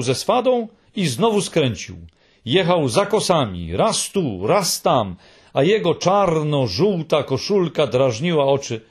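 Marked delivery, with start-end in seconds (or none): none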